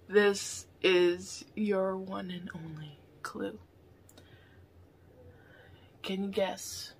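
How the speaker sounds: slow secretive whisper. Real, not acted. Giving viewers the one clue, slow whisper. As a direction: breathe the clue out slowly, hushed.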